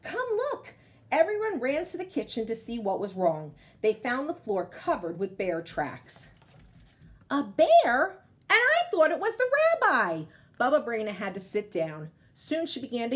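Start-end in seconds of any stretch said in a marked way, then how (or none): 0.0-0.7 high, excited call; children calling out. Acted. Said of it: "cried out". 7.3-10.2 shocked exclamation. Acted. Baba Braina's shocked realisation.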